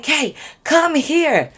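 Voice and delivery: drawn-out call. Retold or imitated. Drunk friends summoning her down the stairs, slow high call. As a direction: drag the name out to pull her over.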